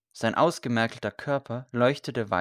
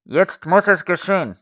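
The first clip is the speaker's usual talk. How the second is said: high, loud order; commanding call; 7 semitones higher, 9 dB louder than usual. A narrator's reading. Knight orders the execution to go ahead, loud command.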